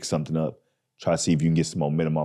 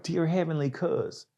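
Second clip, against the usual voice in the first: 1 stage bit title; 10 semitones higher, 6 dB quieter, slower than usual. Retold or imitated. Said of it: "I'm like".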